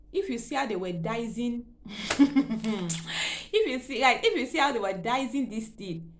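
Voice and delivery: laughing in disbelief; half-laughing through the words; incredulous laughing retelling. Real, not acted. Laughing at doctors dicing fibroid.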